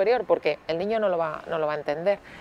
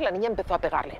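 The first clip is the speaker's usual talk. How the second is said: ordinary talk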